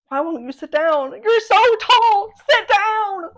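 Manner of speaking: high, loud comic shouting; exasperated mock yell. Comic yelling at the tall librarian to sit, exasperated mock yell. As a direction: build to a drawn-out, high-pitched yell.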